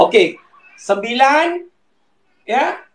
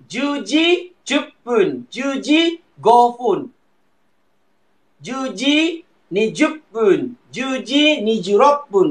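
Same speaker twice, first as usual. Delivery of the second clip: Juji drawn out slowly, each syllable spaced; slow, careful counting drill; slow model phrase; slower than usual. Shown as a demonstration. Teacher drilling Juji numbers slowly for students. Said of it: "Dia bukan Juji ni".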